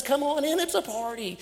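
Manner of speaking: high, loud, bright call inside; cheerful invitation. Acted. Servant calling the older son in to the party, excited. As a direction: beckon him in to the party.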